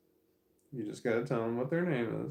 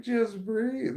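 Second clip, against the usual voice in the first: high mock coaxing; 11 semitones higher, 5 dB louder than usual. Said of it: "it's like".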